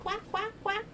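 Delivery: very high squeaky quacking duck; high duck voice asking for the bear. Pretend. Playing a duck toy.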